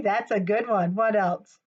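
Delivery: ordinary delivery, nothing marked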